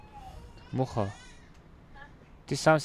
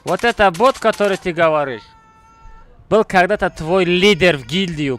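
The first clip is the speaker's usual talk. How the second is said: loud taunting; 4 semitones higher, 8 dB louder than usual. Real, not acted. Taunting rival loudly.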